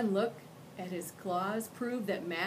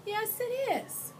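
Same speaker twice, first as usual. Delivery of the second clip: high, prim and courteous monster; a well-mannered creature offering his arm; polite monster voice; slower than usual. Acted. Ralph answers.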